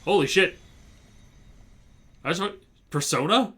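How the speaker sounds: loud stunned outburst; shocked shout. Real, not acted. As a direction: blurt it out in total shock. Reacting to twist in game.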